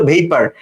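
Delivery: "ভেইপার" with "vei" as in "vay"; correct pronunciation. Shown as a demonstration. Teacher restates it after the memory aid, correct "ভেইপার". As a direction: say "ভেইপার" as VAY-par, not "bhapor". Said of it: "উচ্চারণটা হলো".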